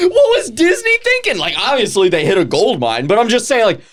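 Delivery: high-pitched laughing disbelief; incredulous outburst. Real, not acted. Host baffled that Disney made this dark movie, incredulous. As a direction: exclaim it, voice leaping up in bafflement.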